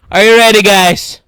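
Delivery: loud announcement. Real, not acted. Streamer announcing the reveal to viewers, loud. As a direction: call it out forcefully.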